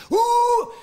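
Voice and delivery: high, loud monkey whoop; howler monkey call. Retold or imitated. Describing the jungle, imitating a howler monkey's loud whoop.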